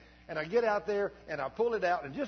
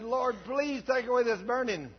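higher, louder, slow pained cry; retold scream; 9 semitones higher, 9 dB louder, slower than usual. Pastor with a burning eye cries out to God, screaming. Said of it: "And I started screaming".

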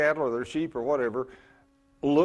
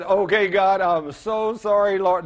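mock repentance; 4 semitones higher, 4 dB louder, slower than usual. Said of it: "And so I said".

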